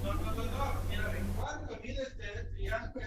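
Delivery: normal talking voice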